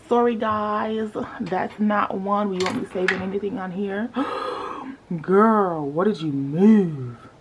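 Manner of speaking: normal talking voice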